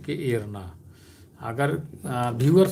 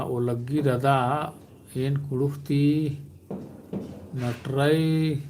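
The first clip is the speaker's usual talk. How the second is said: slow repeat. Shown as a demonstration. Repeating the phrase for clarity.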